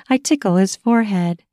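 ordinary delivery

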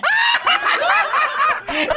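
high shrieking laugh. Real, not acted. Host cackling at the caller's joke, shrieking laugh.

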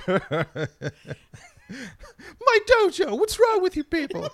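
very high, loud outraged cry. Retold or imitated. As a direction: shriek in mock outrage. Mock outraged dojo owner.

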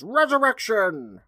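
high, loud, drawn-out showman's proclamation; barker's yell. Acted. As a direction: stretch the word out like a carnival announcer. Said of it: "and the Barker yells".

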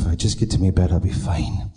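low, muttered bad Irish accent. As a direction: mumble it in a hammy Irish brogue. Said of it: "I have to do it with an Irish, uh, bad Irish accent".

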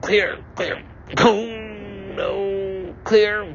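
high, clipped medic calls; hammed-up paramedic clearing; mock medic. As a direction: call it like a paramedic. Mock defibrillator scene.

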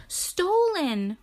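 high voice talking through food; mouth full. Acted. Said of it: "with her mouth full of food".

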